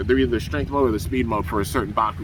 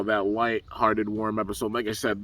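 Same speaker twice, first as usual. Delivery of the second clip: ordinary talk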